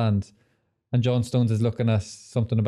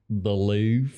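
imitating John Stones. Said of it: "he does an imitation of John Stones".

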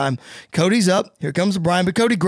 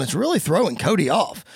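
ordinary delivery, nothing marked

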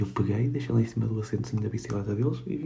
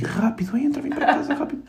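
urgent hushed call; 11 semitones higher, slower than usual. Retold or imitated. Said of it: "Devagarinho, assim".

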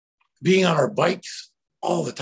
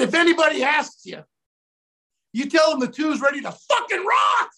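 shouting; 10 semitones higher than usual. Retold or imitated. Actor telling the director the crew is ready, shouting. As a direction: yell it out to rally everyone. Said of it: "I said".

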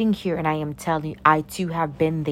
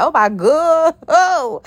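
loud, high, hammed-up gasp of horror; acted shock; 8 semitones higher, 6 dB louder, much slower than usual. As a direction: play someone utterly shaken. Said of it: "they gonna be shooketh".

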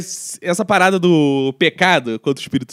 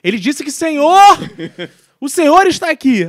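mock interpreter; 6 semitones higher, 7 dB louder than usual. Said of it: "o outro tá".

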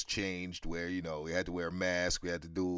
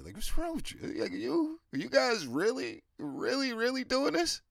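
pitched way up in disbelief; incredulous high outburst; 3 dB louder than usual. Real, not acted. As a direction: ask it in total disbelief. Ranting at vaccinated people.

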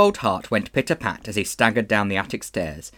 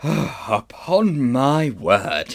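put-on very slow, drawn-out old gentleman; shaken old man; 3 semitones higher, much slower than usual. A narrator's reading. Uncle Andrew to himself after drinking, shaken astonishment. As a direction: let the words heave out on a breath. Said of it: "I'm dreadfully shaken".

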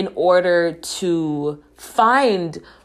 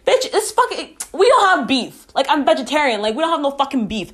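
pitched-up, fed-up comeback; snapping back in disbelief that anyone thinks there's beef; exasperated rant; 8 semitones higher, much faster than usual. Real, not acted. Exasperated rant at clueless guys.

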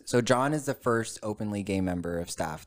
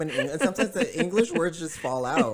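laughing; 9 semitones higher than usual. Real, not acted. Embarrassed about mixing languages.

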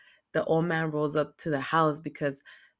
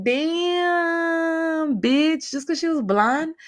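very high, loud, drawn-out disbelief; incredulous outburst; 13 semitones higher, 6 dB louder, much slower than usual. Real, not acted. Viewer reacting to grandpa rejecting the blonde, incredulous outburst. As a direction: wail it out high and loud, scandalized. Own outraged reaction to the film.

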